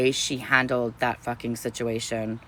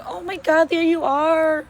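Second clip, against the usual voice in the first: high, adoring gush; gushing greeting; 3 dB louder than usual. Retold or imitated. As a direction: gush at meeting her. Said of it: "I was like".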